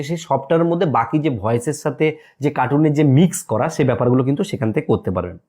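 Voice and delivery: ordinary talk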